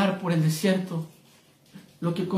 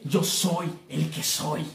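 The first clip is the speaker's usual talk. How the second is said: hushed solemn; 4 semitones lower, 6 dB quieter, much slower than usual. Acted. God's answer, hushed.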